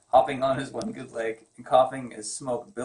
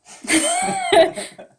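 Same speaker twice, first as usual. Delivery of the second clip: a loud high burst of laughter; 9 dB louder than usual. Real, not acted. Husband cracking up at his misreading.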